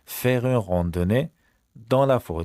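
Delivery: faire une randonnée said very slowly; slow model. Shown as a demonstration. Teacher to class, very slow model. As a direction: lay out each syllable. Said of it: "slow model phrase".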